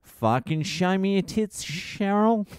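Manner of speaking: half-hearted Australian bloke chat-up; a hint of a crude Australian bogan; mock Aussie bogan. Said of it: "Australian accent".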